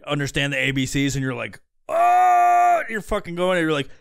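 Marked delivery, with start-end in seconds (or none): 1.9-2.8 high, loud drawn-out moan; exaggerated moaning "oh", rising high; loud mock moan. Man watching his phone, loud hammed-up moan.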